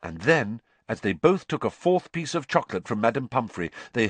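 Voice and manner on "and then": usual speaking voice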